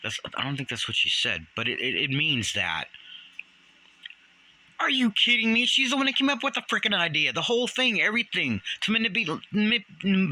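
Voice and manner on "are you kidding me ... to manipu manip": indignant outburst. Real, not acted. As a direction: burst out in real disbelief. Outraged rant about her scheme.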